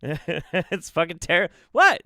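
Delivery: usual speaking voice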